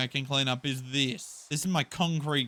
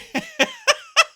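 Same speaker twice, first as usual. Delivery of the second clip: loud, very high burst of laughter; helpless high-pitched laugh; high squealing laugh; 9 dB louder than usual. Real, not acted. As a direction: crack up in a piercing squeal. Cracks up at spinning mobs.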